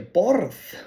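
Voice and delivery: quick native-speaker word; Icelandic word. Shown as a demonstration. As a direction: drop in the word "borð" as a native would. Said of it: "and then they say a".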